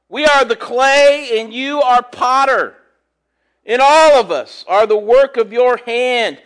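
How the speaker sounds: reading scripture to the congregation. Acted. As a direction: proclaim the verse from the pulpit. Reads scripture aloud.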